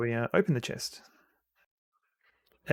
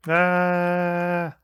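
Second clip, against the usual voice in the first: startled cry; 6 semitones higher, 5 dB louder than usual. Real, not acted. Surprised by a forgotten enemy.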